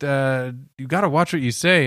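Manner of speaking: ordinary talk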